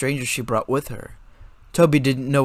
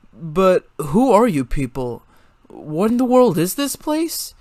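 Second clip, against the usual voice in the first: put-on higher, confused young voice; ask, lost and unsettled; lightly voiced anxious teen; bewildered boy voice; 5 semitones higher, 4 dB louder than usual. A narrator's reading.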